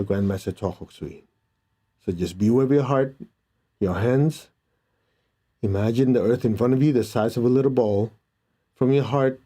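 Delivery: calm meditation guide voice; guided meditation. Acted. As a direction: lead them inward, gently. Leads a meditation.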